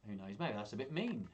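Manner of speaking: plain reading voice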